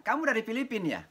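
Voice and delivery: high mock call, mildly loud; retold student shout. A student shouting a guess at the lecturer, mild mock shout. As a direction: imitate a student's eager wrong guess. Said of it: "Ada yang teriak".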